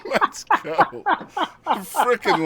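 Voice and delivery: laughing while cheering; high laughing cheer after a joke. Real, not acted.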